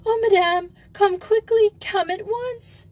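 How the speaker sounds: put-on very high, frightened girl's plea; panicked character voice, pitched far up; scared exclaiming. A narrator's reading. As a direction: cry out for urgent help in a shrieky high voice. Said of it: "who both exclaimed".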